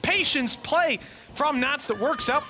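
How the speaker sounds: fast, high, urgent call; esports caster. Real, not acted. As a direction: call the clutch at full hype. Clutch round live call.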